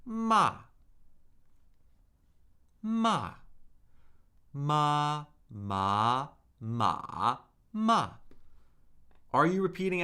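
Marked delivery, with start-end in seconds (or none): 0.0-3.3 "ma" falling sharply from high; fourth tone. Shown as a demonstration. 4.5-8.1 brisk four-tone drill on "ma"; four Mandarin tones. Shown as a demonstration. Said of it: "cycle this syllable through the four tones".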